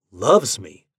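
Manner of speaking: plain reading voice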